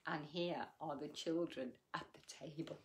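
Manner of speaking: usual speaking voice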